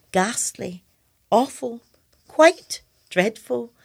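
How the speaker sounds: mock English accent, each word set apart; put-on English accent. Poet showing the new words that marched in, affected English accent.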